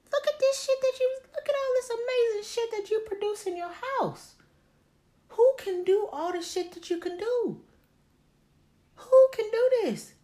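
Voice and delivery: very high, squeaky self-hype voice; mirror hype-up. Acted. Talking to herself in the mirror about her talent, high excited hype. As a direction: gush in a piercing, loud high voice.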